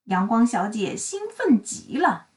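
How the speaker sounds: plain reading voice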